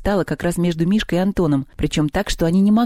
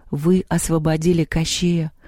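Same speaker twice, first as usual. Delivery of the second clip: usual speaking voice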